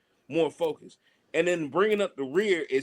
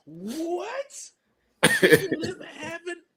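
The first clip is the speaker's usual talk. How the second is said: high, laughing disbelief, much higher; stunned disbelief; 11 semitones higher, much slower than usual. Retold or imitated. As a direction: echo the insult in shock, cracking up. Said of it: "we looking like".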